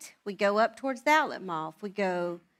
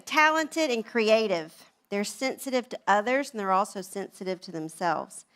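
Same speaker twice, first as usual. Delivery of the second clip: usual speaking voice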